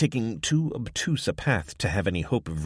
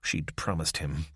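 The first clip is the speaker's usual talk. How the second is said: usual speaking voice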